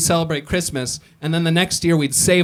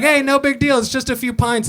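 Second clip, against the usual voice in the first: higher breezy carefree voice; mock British driver; 9 semitones higher than usual. Comic imitating an Uber driver waving off a few pints. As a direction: shrug it off like a tipsy local.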